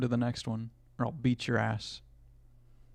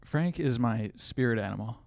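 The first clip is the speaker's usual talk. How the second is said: normal talking voice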